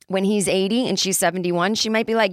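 normal talking voice

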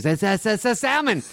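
high, stammered guess; eager guessing waiter. Acted. Waiter straining to recall the diner's order, eager. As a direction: blurt it out high, repeating the first word.

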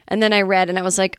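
normal talking voice

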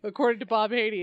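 normal talking voice

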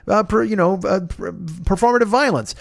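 ordinary talk

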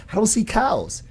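put-on high child voice, a little stubborn; little daughter in the car stubbornly denying the cows, high voice; imitating his daughter. Daughter in the retold story.

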